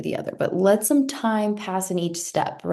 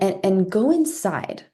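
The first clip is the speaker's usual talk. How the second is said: ordinary delivery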